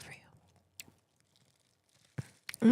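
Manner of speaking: hushed whisper. Real, not acted. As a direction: drop to a whisper. Whispered aside to viewers.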